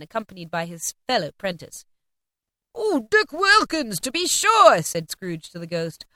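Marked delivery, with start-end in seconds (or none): 2.7-4.8 high, loud, slow exclamation; overjoyed at spotting an old friend; delighted recognition. A narrator's reading. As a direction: savour the name with delight. Scrooge delightedly spots old friend.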